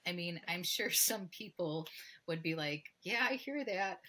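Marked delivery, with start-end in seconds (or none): 3.0-4.0 dismissive quote. Retold or imitated. People dismissing advice, voiced.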